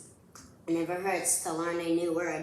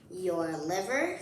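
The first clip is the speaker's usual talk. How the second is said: usual speaking voice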